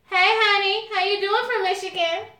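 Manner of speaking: high, bright call to a viewer; light up and greet a fan by name of her state; excited greeting. Real, not acted.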